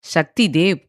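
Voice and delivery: firm, proud naming. A narrator's reading. Sanmathi supplying her brother's name, proudly. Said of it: "என்று கர்வமாக கூறினாள் சன்மதி".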